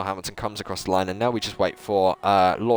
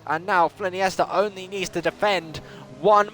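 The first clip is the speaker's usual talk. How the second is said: higher, lively race commentary; live play-by-play; 8 semitones higher than usual. Acted. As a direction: call the lap for the viewers.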